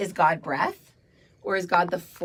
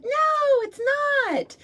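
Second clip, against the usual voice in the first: put-on stamp your foot like a toddler; whiny child; much slower than usual. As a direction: inner child refusing. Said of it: "they're like".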